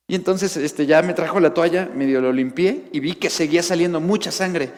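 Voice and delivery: normal talking voice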